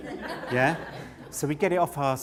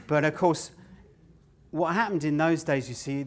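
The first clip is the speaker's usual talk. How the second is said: ordinary delivery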